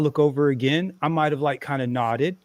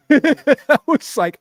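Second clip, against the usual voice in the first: laughing; 9 semitones higher, 9 dB louder, much slower than usual. Real, not acted. Laughing while retelling.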